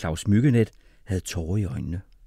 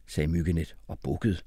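ordinary delivery, nothing marked

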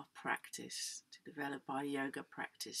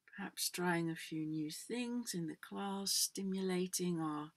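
usual speaking voice

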